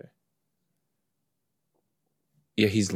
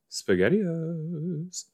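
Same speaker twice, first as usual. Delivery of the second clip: silly drawn-out voice; 9 semitones higher, 4 dB quieter, much slower than usual. Pretend.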